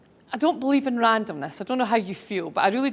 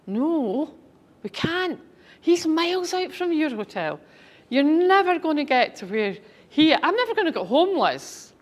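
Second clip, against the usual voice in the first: driver voice; 6 semitones higher than usual. Retold or imitated. Driver refusing the long drive.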